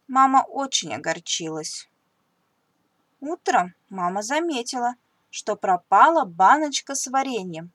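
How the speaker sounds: usual speaking voice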